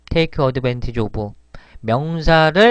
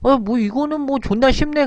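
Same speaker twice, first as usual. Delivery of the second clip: much higher-pitched mock overconfidence; cocky past self; 11 semitones higher than usual. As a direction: imitate your naive younger self scoffing, pitched high. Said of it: "근데 제가".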